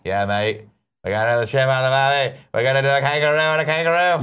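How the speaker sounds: loud, hammed-up Australian gibberish; bad Australian accent. Shown as a demonstration. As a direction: let a botched Australian accent run away with you. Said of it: "I don't know how to keep that up".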